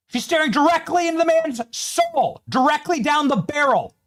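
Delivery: rising, enraged commentary pushed high; getting worked up and shouting at the footage; heated yelling. Real, not acted. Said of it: "Sorry for the yelling".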